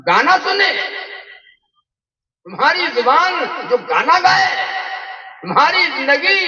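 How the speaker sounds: high, indignant sermon challenge; preaching rebuke. Real, not acted. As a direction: throw each charge at the congregation, pitched high. (ملامت بھرا پرجوش واعظانہ لہجہ)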